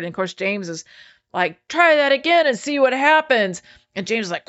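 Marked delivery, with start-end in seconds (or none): 1.7-3.6 raised-pitch imitated challenge; mock threat. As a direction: play James daring them to try it. Acting out James threatening spitters.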